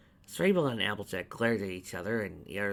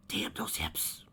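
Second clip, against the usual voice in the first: hushed grumble under breath; whisper; 5 semitones lower, 4 dB quieter, much slower than usual. A narrator's reading. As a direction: mutter it so no one hears. Muttered aside.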